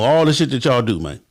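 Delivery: normal talking voice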